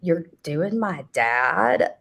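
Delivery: slow, drawn-out appalled question; hammed-up revolted daughter; mock disgust. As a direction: stretch it out, grossed out. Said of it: "changes real quick".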